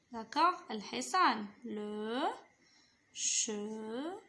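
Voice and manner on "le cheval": "cheval" broken into long, low "che", pause, "val"; syllable by syllable. Shown as a demonstration. Teacher repeating the horse word, each syllable of "cheval" held apart. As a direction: sound out "cheval" very slowly in two pieces. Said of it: "On va former des mots".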